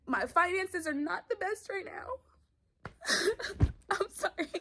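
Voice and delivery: real tears breaking through the words; crying. Real, not acted. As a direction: let the voice crack as you cry. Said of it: "Maybe I should wait till I'm done crying".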